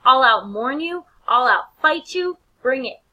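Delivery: a brash, shouted brag; taunting shout. Retold or imitated. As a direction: goad Laertes loudly, one boast after another. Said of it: "And he's like".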